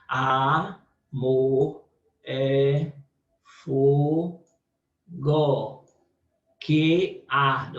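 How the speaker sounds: each word of "Amor é fogo que arde" set apart by long pauses; verse read syllable by syllable. Shown as a demonstration. Teacher reads the verse to divide its syllables, with long pauses. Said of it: "eu vou dividir ele".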